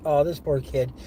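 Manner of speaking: usual speaking voice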